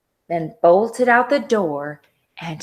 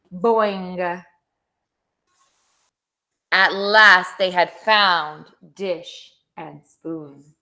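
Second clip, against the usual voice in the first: ordinary delivery, nothing marked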